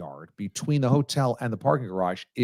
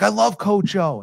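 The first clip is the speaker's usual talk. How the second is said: excited gush; 8 semitones higher, 3 dB louder than usual. Retold or imitated. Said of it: "So she's like".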